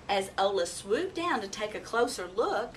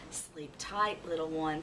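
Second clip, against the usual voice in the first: tender; 6 semitones lower, 5 dB quieter than usual. A narrator's reading. Mother owl tucking child in.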